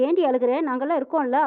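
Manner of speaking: plain reading voice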